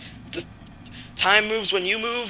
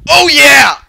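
loud, high victory yell; triumphant shout; 8 semitones higher, 10 dB louder, much slower than usual. Real, not acted. Gamer gloating after destroying the level, triumphant shouting. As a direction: crow over the win at full voice.

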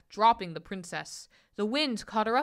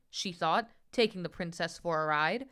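ordinary delivery, nothing marked

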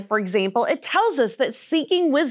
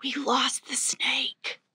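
whispered aside; 2 semitones lower, 9 dB quieter, much slower than usual. Acted. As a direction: whisper it under your breath.

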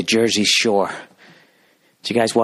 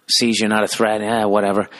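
normal talking voice

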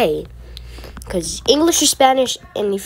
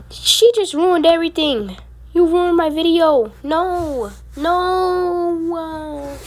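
higher pitched cry of dismay; upset dismay; 7 semitones higher, slower than usual. Real, not acted. Gamer wailing that another player ruined her video. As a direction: protest as it all falls apart. Someone ruined the game run.

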